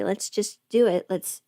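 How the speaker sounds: usual speaking voice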